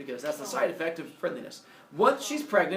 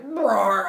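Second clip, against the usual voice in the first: loud, higher-pitched growling cry; mock roar; 4 semitones higher, 9 dB louder than usual. Leto endlessly pregnant and running, roaring. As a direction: let out a comic roar. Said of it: "and she's like".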